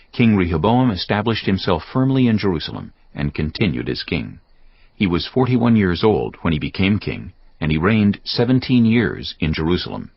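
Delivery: ordinary talk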